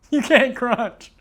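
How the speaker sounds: giggling through the words; laughing. Real, not acted. Laughing at her own flubbed video intro.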